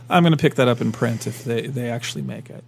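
ordinary delivery

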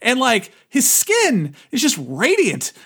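high, drawn-out mock amazement; amazed self-quote. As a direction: replay your astonishment, stretching the end. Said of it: "It's like".

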